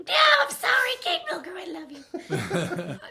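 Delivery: very high, squealed plea; mock apology. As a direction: squeal an apology, slowing into laughter. Mock apology to absent actress.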